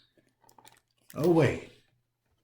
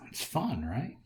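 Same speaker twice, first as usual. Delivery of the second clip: plain reading voice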